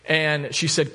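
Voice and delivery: usual speaking voice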